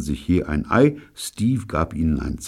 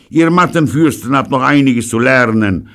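Pirolo scolding; 10 semitones higher, 9 dB louder than usual. Acted. Pirolo scolds his men.

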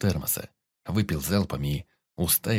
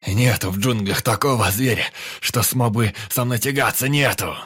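loud, raised triumphant roar; boastful yell; 3 semitones higher, 5 dB louder than usual. A narrator's reading. Blood-soaked fighter boasting to his squad, roaring. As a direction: shout your invincibility at them. Pyotr raging after the kill.

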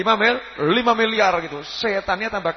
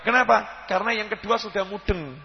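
ordinary talk

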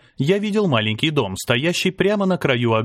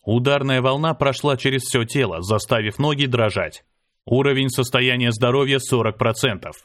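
plain reading voice

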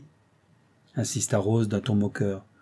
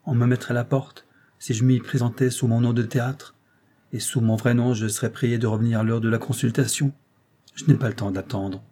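plain reading voice

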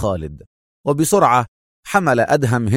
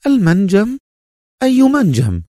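high-pitched, startled woman's questions; high cry of surprise; astonished; 10 semitones higher, 4 dB louder than usual. A narrator's reading. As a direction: exclaim in amazement, voice shooting up. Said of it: "هتفت في دهشة".

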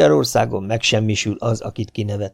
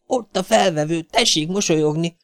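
put-on excited child; 8 semitones higher than usual. A narrator's reading. Domi excited about the camera.

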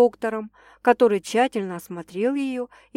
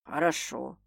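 low, soft sighing assent; quiet accepting man; sighed; 6 semitones lower, 8 dB quieter than usual. A narrator's reading. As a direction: let it out with a sigh. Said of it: "вздохнул Стас".

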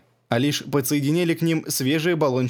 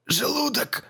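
high, strained cry of pain; old man in agony, straining to speak; squeezed out in pain; 12 semitones higher, 3 dB quieter, slower than usual. Acted. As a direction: force the word out through the pain. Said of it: "выдавил он".

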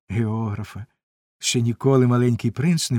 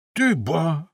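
higher-pitched cry of mild surprise; surprised exclamation; 5 semitones higher than usual. A narrator's reading. Old gentleman spots the little prince, surprised exclamation. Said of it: "вигукнув він".

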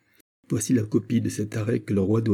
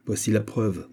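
plain reading voice

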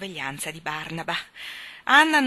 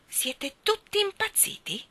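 much higher, slow exasperated demand; irritated; 13 semitones higher, slower than usual. Acted. Doctor fed up with the midnight commotion, irritated. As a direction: snap it out, fed up with them all. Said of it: "disse con irritazione il doctor".

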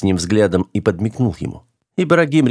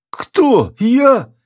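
very high, louder squawk of disbelief; shocked disbelief; 4 dB louder than usual. Acted. Officer recoils when asked to scratch, shocked. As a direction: recoil in high-pitched astonishment.

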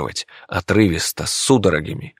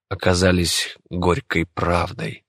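ordinary delivery, nothing marked

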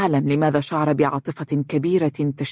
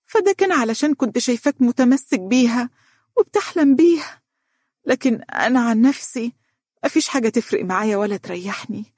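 rising higher and more emotional as she goes; trying to sound firm; 6 semitones higher than usual. A narrator's reading. Sick mother insists to her son, voice climbing higher. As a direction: start firm, then let it climb. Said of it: "تحاول صبغ صوتها بنبرة حاسمة".

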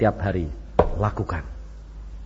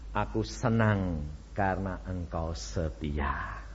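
softer, lower, slow divine voice; God's tender voice; 7 semitones lower, 7 dB quieter, slower than usual. Acted. Preacher voicing God's words to the faithful, hushed and slow. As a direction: speak as God, gentle and slow.

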